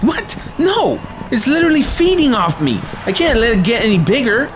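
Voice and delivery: high, panicked protest; alarmed outburst. Acted. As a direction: shriek it in disbelief.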